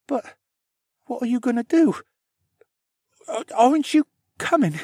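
put-on high-pitched, halting boy's plea; shaking voice. A narrator's reading. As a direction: ask anxiously with a stammer. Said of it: "His voice shook".

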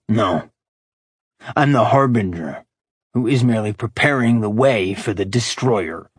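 cold, grand villain declaration; menacing demon voice. Acted. Demon father declaring his role.

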